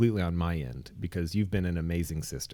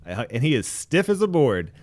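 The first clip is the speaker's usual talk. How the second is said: ordinary talk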